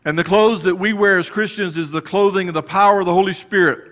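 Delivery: full-force sermon delivery; fervent preaching. Acted. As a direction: preach it out to the congregation. Preaching rises again.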